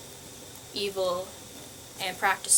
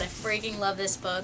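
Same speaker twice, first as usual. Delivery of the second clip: ordinary delivery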